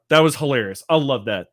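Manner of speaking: normal talking voice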